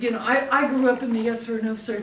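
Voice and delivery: normal talking voice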